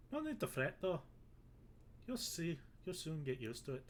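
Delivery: a hammed-up, horrible Irish brogue; bad Irish accent. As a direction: play the Irish sailor, badly on purpose. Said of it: "I've made him Irish".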